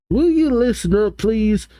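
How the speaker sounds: high-pitched pretend yelling at normal volume; mock shout. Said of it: "Bill shouts".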